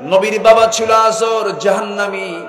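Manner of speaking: loud, high, chanted preaching; full-force sermon. Acted. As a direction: preach it out to the crowd, half sung. (উচ্চস্বরে সুর করে ওয়াজ)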